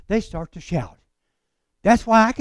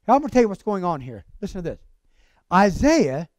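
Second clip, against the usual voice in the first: ordinary talk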